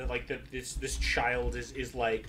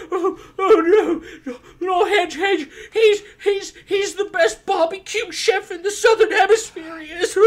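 high, loud, hammed-up sobbing; weeping mayor; 12 semitones higher, 6 dB louder than usual. Sobbing mayor wails about the barbecue chef, hammed-up crying. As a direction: wail it through tears. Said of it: "still weeping in the corner".

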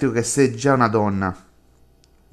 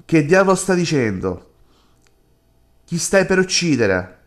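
shocked reaction; 4 semitones higher than usual. Real, not acted. Player shocked at character's plan.

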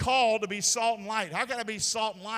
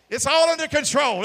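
mock official reassurance; 6 semitones higher, 11 dB louder than usual. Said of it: "don't lie to us and say it ain't happening".

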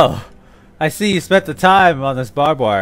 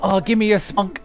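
higher hammed-up British actor; mock British accent; 6 semitones higher, 4 dB quieter than usual. Imitating British porn actors, silly British accent. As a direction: imitate overacted British film dialogue. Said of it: "when I watch some British porn".

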